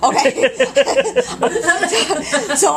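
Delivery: high, loud talking through laughter; real laughter. Real, not acted. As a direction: struggle to get the words out, laughing. Breaks up laughing while telling story.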